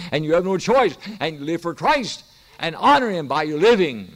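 impassioned sermon delivery; fervent preaching. Acted. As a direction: preach it out to the congregation. Exhorts congregation on giving.

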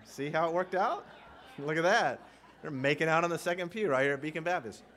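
ordinary talk

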